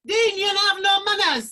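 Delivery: scolding. Real, not acted. Woman telling off Dean for interrupting, loud scolding. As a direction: call him out on his manners.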